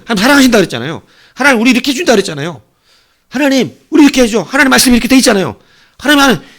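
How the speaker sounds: high, loud insistent pleading; pleading cry. Retold or imitated. Watchman calling out to God to remember, loud pleading. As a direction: keep crying out to God, urgent and rising. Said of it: "계속 하나님한테".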